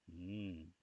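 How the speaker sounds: ordinary talk, nothing marked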